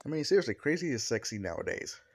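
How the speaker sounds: plain reading voice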